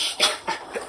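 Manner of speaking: soft breathy laugh; quiet cracking-up laugh; amused laugh. Real, not acted. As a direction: laugh helplessly under the breath. Cracking up at viewers' comments.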